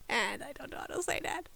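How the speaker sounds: unvoiced whisper to herself; whispered confusion; whispered aside. Real, not acted. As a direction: mutter it under your breath. Muttering to herself, unsure.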